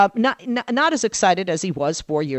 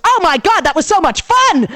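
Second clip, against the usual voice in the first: higher and louder, gushing; a husband raving about the vice presidential debate, excited; thrilled husband; 11 semitones higher, 6 dB louder than usual. Retold or imitated. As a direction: gush like a fan after a great show.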